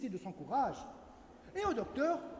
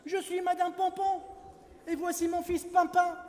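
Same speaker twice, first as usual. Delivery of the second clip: higher, louder lady's voice; mother rabbit; 7 semitones higher, 4 dB louder than usual. Acted. Mother rabbit introducing her son to the doctor, higher and louder. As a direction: announce yourself, a bit grand.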